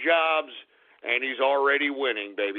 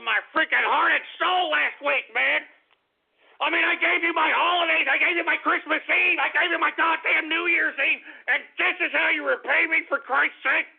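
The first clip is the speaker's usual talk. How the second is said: indignant rant; 11 semitones higher than usual. Real, not acted.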